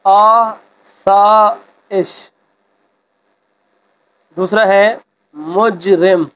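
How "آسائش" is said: آسائش spelled out very slowly, each syllable drawn apart; careful pronunciation. Shown as a demonstration. Teacher modelling correct pronunciation for students, very slow. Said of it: "اعراب کی مدد سے درست تلفظ".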